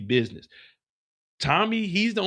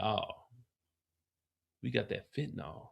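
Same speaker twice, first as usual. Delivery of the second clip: Diamond's voice; 4 semitones lower, 13 dB quieter than usual. Retold or imitated. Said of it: "He's like".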